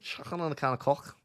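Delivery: jokey Scouse with "Coke" sounding like "cock"; playful Scouse attempt, short vowel in "Coke"; mock Scouse. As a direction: put on a hammed-up Scouse accent. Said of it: "sounds like I'm saying the, the wrong word".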